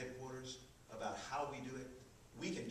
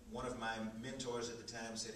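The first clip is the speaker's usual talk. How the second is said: ordinary talk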